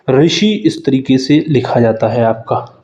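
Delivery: ordinary delivery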